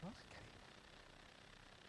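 breathy whispered doubt; hushed skeptical mutter; whispered aside. Retold or imitated. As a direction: whisper it like a private worry. Imagined reaction to shabby place.

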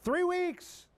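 high-pitched disbelief at the number; incredulous repeat. Real, not acted. Preacher telling of a troublemaker, incredulous.

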